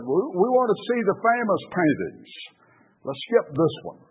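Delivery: high-pitched objection from the back; acted impatient protest; impatient heckler. Bidder objects from back of room.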